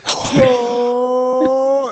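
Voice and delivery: high, drawn-out fake wail; mock crying. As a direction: bawl like a raver overcome with pride. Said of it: "That's how they all cry".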